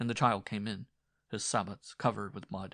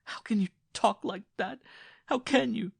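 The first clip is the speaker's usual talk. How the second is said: much higher, tearful pleading; wife sobbing behind her apron; 13 semitones higher, 8 dB louder than usual. A narrator's reading. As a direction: cry out through sobs.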